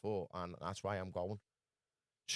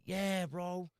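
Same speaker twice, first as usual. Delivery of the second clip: much higher mock street voice; a hammed-up higher drawl of agreement; imitating the stranger; 9 semitones higher than usual. The stranger answering him, in a higher mock voice. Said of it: "And he's like".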